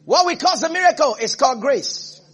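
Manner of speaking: high, loud sermon delivery; ringing, emphatic preacher's proclamation; full-force preaching. Real, not acted. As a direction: preach it out to the whole house, pitched high.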